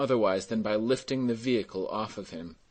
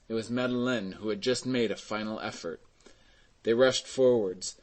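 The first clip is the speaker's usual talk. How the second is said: ordinary delivery, nothing marked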